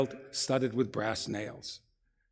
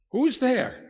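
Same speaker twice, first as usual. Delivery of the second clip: high, louder startled call through the door; a scared sick woman calling out, much higher; frightened; 12 semitones higher, 6 dB louder than usual. Acted. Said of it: "asks a frightened voice".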